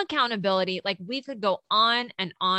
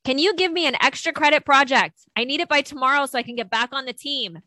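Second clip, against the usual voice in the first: mock demanding student; 6 semitones higher, 4 dB louder than usual. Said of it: "you're getting yelled at right now".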